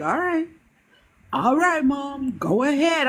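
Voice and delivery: imitating son. Son backing off.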